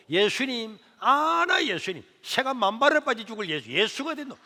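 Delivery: loud, high mocking curses; mother cursing Jesus in rage, loud and high. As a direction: jeer at Jesus furiously. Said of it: "막 욕을 하는 거예요".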